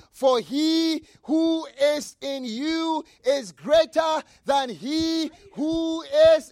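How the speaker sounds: very slow, loud proclamation; shouted verse. Acted. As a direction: land the promise like a victory cry.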